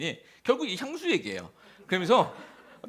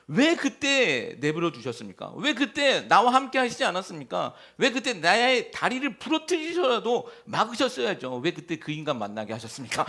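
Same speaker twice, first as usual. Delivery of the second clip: louder, higher pleading reproach; resentful complaint; 4 semitones higher, 5 dB louder than usual. Retold or imitated. Believer complaining to God about past suffering, reproachful. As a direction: accuse God with hurt and frustration. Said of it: "라고 원망하잖아요".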